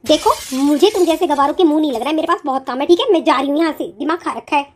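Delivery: low, cold brush-off; dismissive sister-in-law. Acted.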